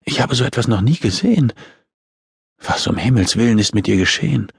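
a touch of horror; mildly aghast woman's voice; horrified. A narrator's reading. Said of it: "Die Stimme der Frau klang entsetzt".

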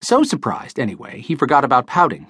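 ordinary delivery

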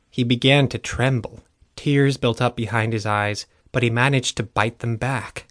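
ordinary delivery

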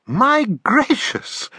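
slow high wondering exclamation; amazed. Acted. Taffy amazed at their pictures: slow high wonder. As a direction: marvel at it, slowly.

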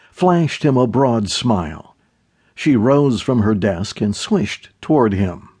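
ordinary talk

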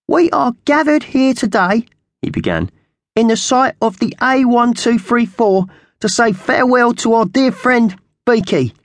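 very high-pitched magpie opening a funeral; scrawny magpie character. Acted. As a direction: squawk a formal eulogy in a high voice. Voices Slasher the magpie.

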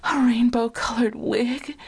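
husky, drawn-out sultry guess; husky caller. A narrator's reading. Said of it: "said the husky, familiar voice".

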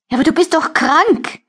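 high, whimpering protest; a tearful captive whimpering at her captor. Acted. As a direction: plead through tears. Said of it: "Ich wimmere".

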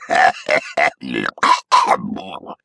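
put-on gurgling old-man chuckle; wet gagging chuckle. A narrator's reading. Ghost leaves through the wall, a wet gagging chuckle. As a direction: chuckle through a throat full of water. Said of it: "Clay gagged on another wet chuckle".

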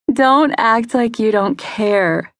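slow, teasing, smiling; smile in her voice. A narrator's reading. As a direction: tease her gently, slowly. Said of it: "with a smile in her voice".

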